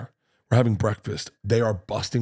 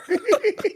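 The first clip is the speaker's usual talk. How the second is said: high laugh. Real, not acted. Laughs at daughter's punchline.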